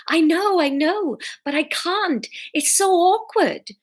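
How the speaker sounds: high-pitched, lilting Welsh plea; pleading Fiona. A narrator's reading. Fiona admits she can't do it, pleading.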